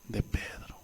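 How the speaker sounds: soft whispered ending. Real, not acted. Preacher closing Peter's story to the congregation in a whisper. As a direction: drop to a hushed whisper.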